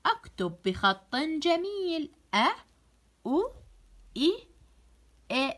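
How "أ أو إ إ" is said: "أ، أو، إ" short and separate; isolated hamza vowels "أ، أو، إ", pauses between; letter sound drill. Shown as a demonstration. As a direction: sound out each of "أ، أو، إ" alone. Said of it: "منتبها إلى صوت الحرف".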